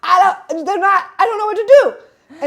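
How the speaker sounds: high, loud flustered stammer; panicked stammer. Retold or imitated. Trapped on the bus calling to her friend, panicked loud stammer. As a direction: blurt it out in a rising panic, tripping over the words. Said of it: "panic sets in".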